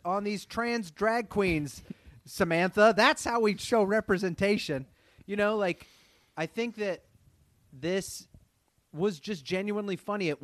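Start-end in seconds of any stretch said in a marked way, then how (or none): 0.0-4.9 higher, emphatic preachy parody; hammed-up pronouncement, pitched up from chat. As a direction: lecture them as a self-important TV writer.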